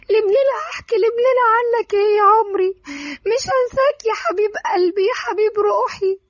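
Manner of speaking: high-pitched sobbing lament; pained, tearful. Acted. Darin mourning Iyad at his grave, pained tearful wailing. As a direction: act out raw grief, high and crying. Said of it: "بكل وجع ودموع".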